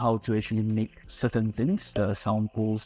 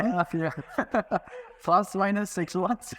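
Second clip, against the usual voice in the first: laughing answer; 8 semitones higher, slower than usual. Real, not acted. Laughs answering timing question.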